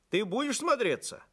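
high, eager question; hurried. A narrator's reading. Feldsher catches the girl at the door, hurried. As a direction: ask it in a mild hurry. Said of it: "торопливо спросил её Максимыч".

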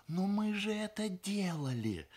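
high, urgent, mock stage whisper, fully voiced; desperate prompting. A narrator's reading. Bride prompting her stumped fiancé, desperate. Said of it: "отчаянно шепнула ему невеста".

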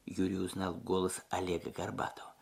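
ordinary delivery, nothing marked

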